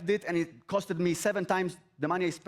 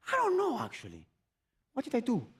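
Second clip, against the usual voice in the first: bewildered; 6 semitones higher than usual. Retold or imitated. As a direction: shrug it off in wonder. Retold self-dialogue, bewildered.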